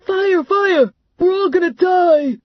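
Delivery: very high, loud panicked shout; panicked yell. A narrator's reading. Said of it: "Clown yelled".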